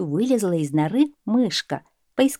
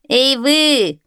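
high, loud call into the burrows; shouting; 6 semitones higher, 5 dB louder than usual. A narrator's reading. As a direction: yell at the holes. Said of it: "закричал охотник".

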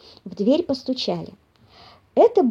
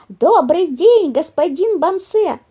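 high, loud, drawn-out mice's call; shouted greeting; 6 semitones higher, 5 dB louder, slower than usual. A narrator's reading. Mice greeting papa bear, shouting. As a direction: call it out slowly in chorus style. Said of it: "хором закричали мышата".

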